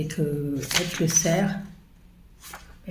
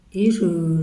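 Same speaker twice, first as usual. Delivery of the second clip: ordinary talk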